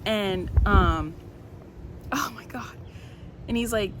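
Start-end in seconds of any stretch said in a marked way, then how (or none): 2.1-2.8 whispered. Real, not acted. Reacting while reading the book.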